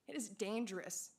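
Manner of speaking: ordinary talk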